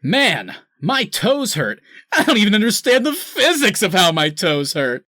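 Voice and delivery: high, loud, strained whine; mocking Cyborg's awkward toe line; uncomfortable laughing whine. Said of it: "laughing uncomfortably and going".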